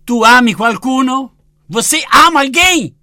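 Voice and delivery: high, loud booming call; God calling out. Retold or imitated. The Lord cries out to him in prayer, high and loud. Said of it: "il Signore gridò".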